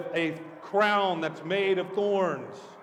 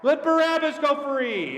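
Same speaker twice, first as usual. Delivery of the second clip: high, loud crowd demand; yelling; 10 semitones higher, 5 dB louder than usual. Acted. Man in the crowd demands Barabbas from Pilate, yelling. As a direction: yell it out to free the prisoner. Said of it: "and one yelled out".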